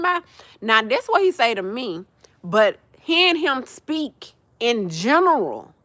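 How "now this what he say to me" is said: normal talking voice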